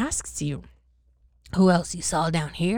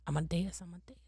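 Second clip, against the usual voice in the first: whispered to self; 13 dB quieter, faster than usual. Real, not acted. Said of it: "Sorry, sorry".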